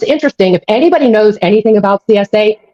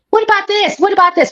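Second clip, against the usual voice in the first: mock whiny question, pitched much higher; mocking whine; 10 semitones higher than usual. Mocking the crowd's what-about questions, pitched high and whiny. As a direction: mimic the whiny crowd, high and sing-song. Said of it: "Everyone says".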